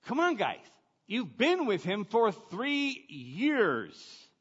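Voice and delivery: judging listeners scolding the disciples, slow and exasperated. Retold or imitated. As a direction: roll your eyes at the disciples. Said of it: "We're all like".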